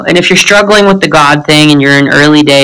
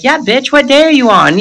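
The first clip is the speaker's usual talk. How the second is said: higher, cheeky sassy voice; 8 semitones higher than usual. Retold or imitated. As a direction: toss it off like a brash sponsor. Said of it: "And she was like".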